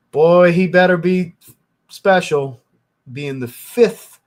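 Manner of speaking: a frustrated fan's slow, loud warning; worked-up warning. Real, not acted. Fan warns about the draft pick, loud and slow. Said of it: "I'm getting worked up".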